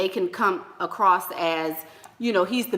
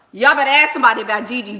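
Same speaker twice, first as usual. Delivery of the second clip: normal talking voice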